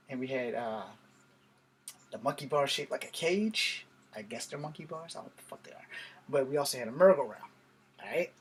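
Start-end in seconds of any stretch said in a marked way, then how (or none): 4.1-5.9 breathy whispered aside, under breath. Real, not acted. Muttered aside about monkey bars.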